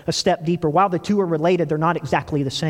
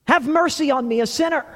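raised, higher pleading cry; blind man calling out; 9 semitones higher than usual. Retold or imitated. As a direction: call out for mercy, voice lifted high. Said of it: "This blind man calling out".

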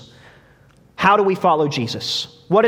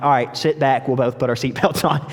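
not mad anymore; 5 semitones lower than usual. Retold or imitated. Caught-out driver to younger brother, sheepishly giving in. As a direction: back down, giving in to the point. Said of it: "So then I can't be mad".

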